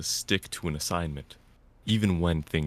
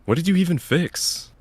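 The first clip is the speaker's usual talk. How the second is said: exasperated question; 13 semitones higher, 7 dB louder than usual. Acted. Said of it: "I asked".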